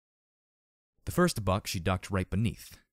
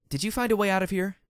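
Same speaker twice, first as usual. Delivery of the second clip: raised voice across room; calling out; 12 semitones higher, 6 dB louder than usual. A narrator's reading.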